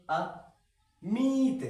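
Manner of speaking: अमित very slow, with a high, held i; long i. Shown as a demonstration. Teacher builds the big matra word, long high i. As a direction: break अमित after the a, then stretch the i high. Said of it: "बड़ी की मात्रा आ रही".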